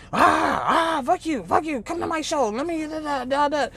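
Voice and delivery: imitating him yelling; mock yelling. Mimicking Godfrey yelling on the phone, mock rant. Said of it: "You started to yell and scream".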